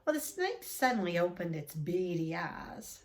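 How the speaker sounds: plain reading voice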